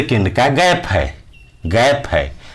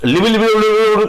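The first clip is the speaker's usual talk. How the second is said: higher, rattled-off nonsense babble; mock fast gibberish; 6 semitones higher than usual. Teacher mimicking someone who talks too fast, higher gibberish babble. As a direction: mimic a talker whose words blur together.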